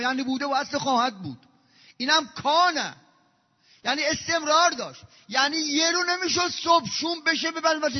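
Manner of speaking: loud, high impassioned preaching; preaching at full force. Acted. Preacher thundering to the congregation, loud and high. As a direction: pour it out from the pulpit.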